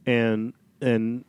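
ordinary delivery

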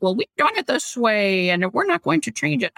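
mock a stubborn boss, pitch loosely up and down. Host mimicking business owners who won't change, mocking. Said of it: "My mocking voice".

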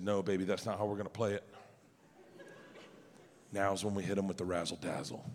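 low, soft, slick scheming dad; low schemer voice. Retold or imitated. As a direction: lean in and sell the plan softly. Dad's smooth low scheming voice.

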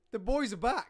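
hyped announcer opener; show opener. Real, not acted. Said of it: "start the show how I wanted".

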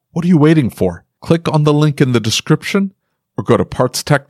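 upbeat sponsor read. Acted. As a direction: sell it like a podcast ad.

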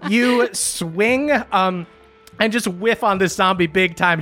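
ordinary talk, nothing marked

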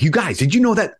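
breathless excitement. Retold or imitated. Telling siblings her big discovery.

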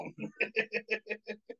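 soft, high chuckle; amused laugh. Real, not acted. Friend retelling a drive-through prank, amused laugh. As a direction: laugh quietly at your own story.

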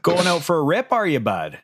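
high rural Ontario lilt, dropping at the end; rural Ontario accent. Acted. Buddy asking a friend about his plans, rural Ontario accent. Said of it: "more of your rural Ontario".

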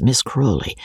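ordinary delivery

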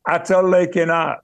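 broad Yorkshire dialect, words run together. Shown as a demonstration. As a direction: say it as a Yorkshire lad would. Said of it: "it was dialect".

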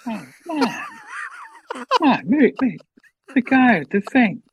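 high, folksy old politician impression; Joe Biden. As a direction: fumble along like an ageing president. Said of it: "that's my Biden".

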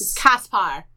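Kaspar with a hard K and open ah vowels; German name. Shown as a demonstration. Cohost teaching the German name, careful pronunciation. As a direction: say Kaspar the German way, KAHS-par. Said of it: "'cause he's German".

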